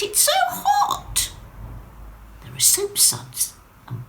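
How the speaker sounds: a tiny drop exploring a strange sea; curious Wendy. Acted. Drop in the bath puzzling over waves and a bird, high and curious.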